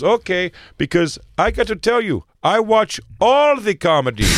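high-pitched mock Indian cabbie; Indian accent. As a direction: imitate an Indian driver laying it out emphatically. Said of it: "with an accent like that".

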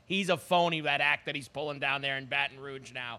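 ordinary delivery

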